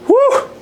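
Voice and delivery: short, loud, very high whoop; high victory whoop after effort; celebratory whoop. Real, not acted. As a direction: let out an elated woo. Celebrating after a good set.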